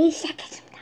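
barely voiced whisper. Shown as a demonstration. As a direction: hush it to a whisper. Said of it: "조그맣게 하는 거지".

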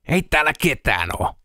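pained words through gritted teeth. A narrator's reading. Peltonen with a crushed foot reports the empty room, through gritted teeth. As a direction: say it clenching against the pain. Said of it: "Peltonen sanoi hammasta purren".

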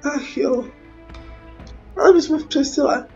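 ordinary talk

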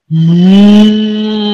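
long, drawn-out moo; low like a cow, holding the sound. Shown as a demonstration. Teacher shows pupils what a cow says, mooing. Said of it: "A cow moons".

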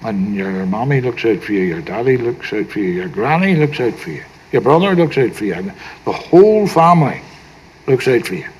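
ordinary delivery

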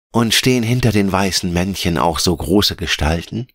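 put-on high-pitched, wondering little-girl character; Veronika, gespannt und erfreut. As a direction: chatter as a curious child, delighted. Voices Veronika in story. (hohe, staunende Kinderstimme)

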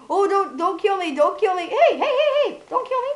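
high, laughing squeal of fake panic; mock panicked pleading. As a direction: squeal and beg through laughter. Playfully pleading not to be killed.

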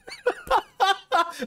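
high-pitched cackling laugh; giddy laugh. Real, not acted. Laughing at his own brush with fame, giddy cackle. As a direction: burst into a high giggle.

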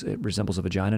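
ordinary delivery

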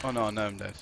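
usual speaking voice

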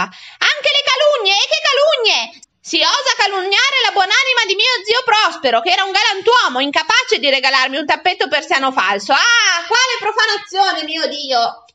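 high, loud, melodramatic outrage; theatrical lament of an insulted woman; scandalised tirade. A narrator's reading. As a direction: wail in wounded indignation, stretching the first words. Outraged Matilde continues her yelling tirade.

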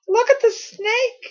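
high, slow, frightened shriek. A narrator's reading. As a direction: shriek it out in terror. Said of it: "she shrieked".

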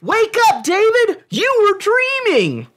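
put-on high, loud excited kid voice; excited child. Sibling waking David on Christmas morning, excited. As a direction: wake him up bursting with Christmas joy.

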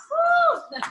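excited high-pitched whoop. Real, not acted. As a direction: let out a big cheer. Cheering class on.

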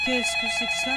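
sudden high-pitched alarmed question; startled. Real, not acted. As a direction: caught off guard, voice shoots up. Startled by something in the game.

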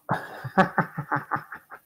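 airless giggle, high; real unvoiced giggle at the mess-up; laughing at own failure; breathy laugh. Real, not acted. Laughing at own bad play.

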